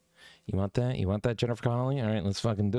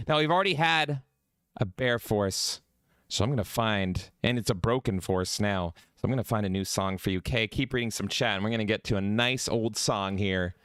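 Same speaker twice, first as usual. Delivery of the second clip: ordinary delivery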